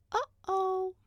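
put-on very high little-girl voice; high-pitched worried child; worried Sally. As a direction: sound a small, high alarm as the girl.

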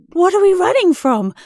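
high, loud, urgent gasp; gasped question. A narrator's reading. Rebecca to Grisby while fleeing, gasped. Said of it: "she gasped".

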